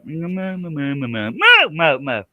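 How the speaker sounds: choppy moos jumping up and down in pitch; stumbling cow impression; tripping cow moo. Shown as a demonstration. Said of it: "Have you ever heard a cow trip".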